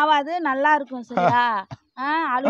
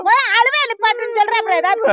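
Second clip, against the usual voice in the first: exasperated scolding; 7 semitones higher, 7 dB louder, faster than usual. Acted. Sister scolds crying brother.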